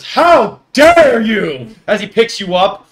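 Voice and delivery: loud offended giant voice; dopey cartoon bellow. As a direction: boom it out like an indignant giant.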